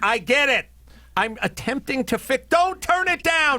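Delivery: high, building to a yell; rising exasperated shout; heated family shouting. Retold or imitated. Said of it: "And I'm like".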